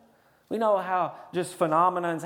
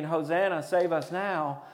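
ordinary talk, nothing marked

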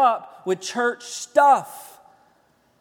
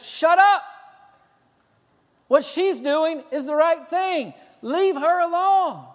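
sharp rebuke; 8 semitones higher, 5 dB louder than usual. Retold or imitated. Jesus turning on the disciples, sharp angry rebuke. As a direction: cut them off hard then settle.

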